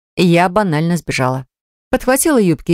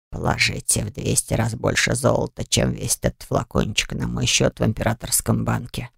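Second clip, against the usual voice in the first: put-on much softer, low old man's whisper; 5 semitones lower, 7 dB quieter than usual. A narrator's reading. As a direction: lean in and whisper like an old man. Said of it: "прошептал старик".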